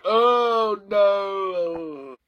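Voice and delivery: high, drawn-out wail; long hammed-up cry of dread; mock dismay. Reader reacts to the book, wailing mock dismay. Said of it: "I was like".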